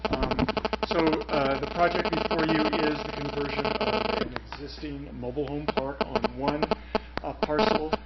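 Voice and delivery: talking through electronic buzz on the mic; buzzing phone interference. Real, not acted. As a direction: voice under a buzzing phone noise. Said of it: "I can't hear him with that going on in the background".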